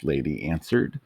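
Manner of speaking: usual speaking voice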